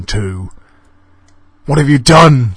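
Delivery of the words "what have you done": high loud scream; Donald's terrified scream as his wrist vanishes. A narrator's reading. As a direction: shriek it in horror. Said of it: "screamed Donald".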